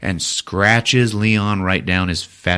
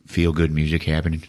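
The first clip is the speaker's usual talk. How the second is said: ordinary delivery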